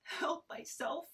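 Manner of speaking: high, panicky pleading; panicked. Acted. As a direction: beg while hanging on by one hand. Panicked character dangling.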